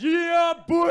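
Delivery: very high, loud whoop of pride; triumphant shout. Acted. Dad answering his son's compliment, triumphant high shout. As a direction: crow it at full volume. Dad's acted triumphant reply.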